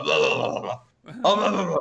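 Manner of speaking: imitation gruff gibberish. Gruff biker babbling at a novice, gruff voice. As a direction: grumble nonsense like a gruff biker. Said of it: "he had quite a gruff voice".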